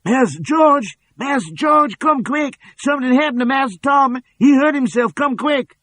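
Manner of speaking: very high, loud alarmed cry; sudden alarm. A narrator's reading. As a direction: shriek for help as if panicked. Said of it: "Cassie's voice in sudden alarm".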